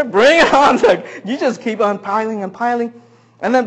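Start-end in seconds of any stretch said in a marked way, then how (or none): 0.0-0.8 high, loud and game for more; eager diner. Acted. Diner answering waiter's offer of more chips, eager. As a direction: welcome the refill with gusto.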